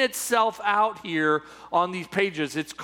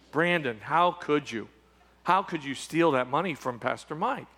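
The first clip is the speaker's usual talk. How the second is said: low, pretend-disappointed scolding; mock reproach; 7 semitones lower, 4 dB quieter than usual. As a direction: play at being let down by him. Acting out congregation scolding Brandon.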